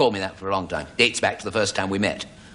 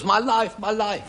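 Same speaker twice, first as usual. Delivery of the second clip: higher, wailing my life; upset man's wail; 12 semitones higher, slower than usual. Retold or imitated. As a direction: wail it like a man whose drink was spilt. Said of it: "He goes".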